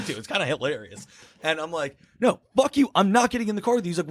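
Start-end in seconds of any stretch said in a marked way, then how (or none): 2.2-3.9 high-pitched, agitated mock protest; acted frantic refusal. Runner refusing the taxi driver, high frantic protest.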